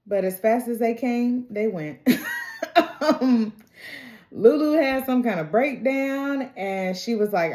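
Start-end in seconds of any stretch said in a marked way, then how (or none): 2.0-2.9 a quick high-pitched giggle. Real, not acted. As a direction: laugh it off lightly. Laughing at the couple's breakup.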